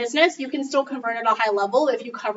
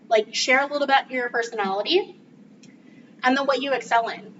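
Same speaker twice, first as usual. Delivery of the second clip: ordinary talk, nothing marked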